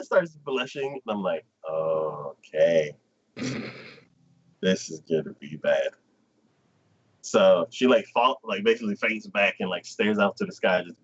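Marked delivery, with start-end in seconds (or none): 1.7-5.9 lower, dry deadpan; flat foreboding aside; deadpan dread. Retold or imitated. Own reaction, deadpan.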